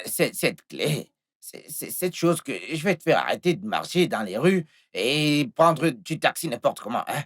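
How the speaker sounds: low, soft, placating voice; calm an upset sweetheart gently, keeping it low; hushed coaxing reassurance; soothing reassurance. Acted. Reassures lover, offers car keys.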